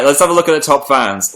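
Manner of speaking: ordinary delivery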